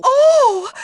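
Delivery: high, loud shocked oh; shocked cry. Acted. Teacher catching a student in the act, crying out in shock. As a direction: recoil with a sharp gasp.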